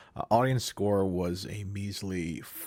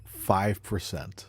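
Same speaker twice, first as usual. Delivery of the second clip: slow dramatic reveal; 2 semitones lower, much slower than usual. Real, not acted.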